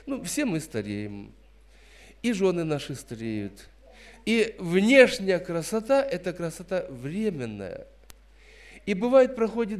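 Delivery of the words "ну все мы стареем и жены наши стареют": ordinary delivery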